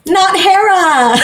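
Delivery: high, drawn-out laughing exclamation; gleeful interjection. Real, not acted. Co-host cutting in on Zeus's parentage, gleefully exclaiming. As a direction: jump in with the obvious twist, laughing. Gleefully jumps in with expected twist.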